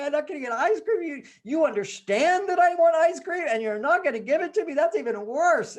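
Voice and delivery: put-on high, loud child's wail; hammed-up tearful protest building in indignation; mock crying. Daughter protests to father about ice cream, mock crying.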